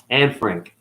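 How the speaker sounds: ordinary delivery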